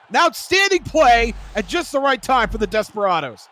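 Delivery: loud, high hyped praise; excited. Real, not acted. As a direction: lift it up with excitement. Same phrase said hyped.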